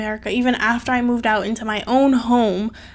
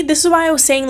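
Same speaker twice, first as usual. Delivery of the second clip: ordinary talk, nothing marked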